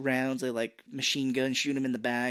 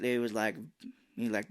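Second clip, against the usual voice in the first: normal talking voice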